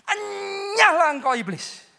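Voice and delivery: loud, drawn-out commanding rebuke; acted rebuke. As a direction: cast out the devil, high attack falling away slowly. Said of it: "Yesus menghardi iblis". (menghardik iblis dengan lantang)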